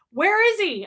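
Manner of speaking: high, loud, hammed-up worry; frantic searching. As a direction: call out as if hunting for a lost cat. Retells frantic search for missing cat.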